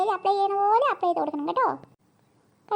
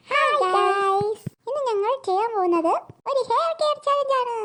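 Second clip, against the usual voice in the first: vlog intro; 3 semitones higher than usual. Acted. Sisters start a vlog.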